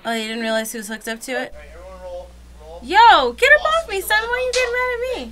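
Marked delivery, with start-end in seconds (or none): 2.8-5.3 1 mock gamer yell. Voicing the gamer in clip.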